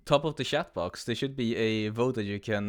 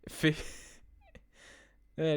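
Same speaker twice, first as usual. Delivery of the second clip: laughing; 5 semitones higher than usual. Real, not acted. Streamer cracks up at vote result.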